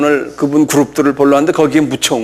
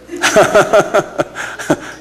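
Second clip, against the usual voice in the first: 웃음; 4 semitones higher than usual. Real, not acted. Speaker laughs at own remark.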